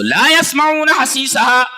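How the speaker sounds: slow, drawn-out chanted verse; slow melodic recitation; Quran recitation. Acted. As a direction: stretch each syllable like a reciter.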